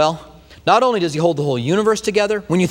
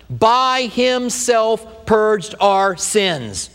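very slow, weighty sermon delivery; slow solemn preaching; 4 semitones higher, much slower than usual. Acted. Pastor to congregation on the cross, slow and solemn. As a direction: draw out each phrase of the cross.

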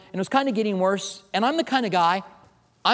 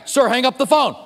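louder guard command; 8 semitones higher, 7 dB louder than usual. Retold or imitated. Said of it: "The security guy".

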